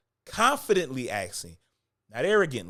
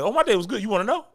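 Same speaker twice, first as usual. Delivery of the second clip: higher and louder, taken aback; startled, overeager reply; shocked; 7 semitones higher, 6 dB louder, faster than usual. Retold or imitated. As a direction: blurt it like the question caught you off guard. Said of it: "I'd be shocked".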